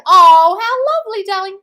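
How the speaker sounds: loud, high squeal of thanks; delighted gushing. Real, not acted. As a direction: gush over a kind gift. Thanking a viewer for a gift offer.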